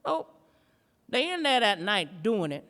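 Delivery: ordinary talk, nothing marked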